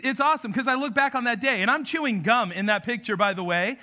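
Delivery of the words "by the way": ordinary talk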